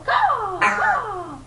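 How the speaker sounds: high-pitched crow call; crow caw. Shown as a demonstration. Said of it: "What's a crow say?".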